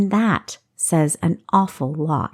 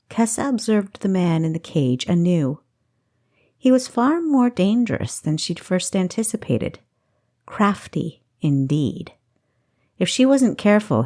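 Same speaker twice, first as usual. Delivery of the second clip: ordinary delivery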